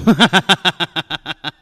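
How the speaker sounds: big booming laugh, amused; hearty laugh. Acted. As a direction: laugh out loud at the bluff. Gutman laughs at Spade's threat.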